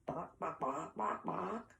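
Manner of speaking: soft, short clucks; hen clucking. Acted. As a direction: imitate a hen with quiet little bawks. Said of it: "And a hen".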